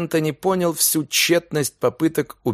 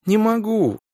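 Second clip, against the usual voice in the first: plaintive sob; 9 semitones higher than usual. Acted. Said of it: "жалобно всхлипнула она".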